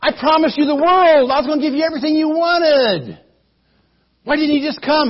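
a host annoyed by the wait; lightly voiced impatient king; a hint of impatient king. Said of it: "Balak comes out and says".

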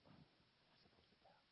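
barely audible whispered aside; hushed, voiceless reluctance; secretive whisper. Acted. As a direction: whisper it as a guarded confidence. Samson hesitates to reveal secret.

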